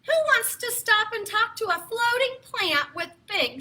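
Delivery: usual speaking voice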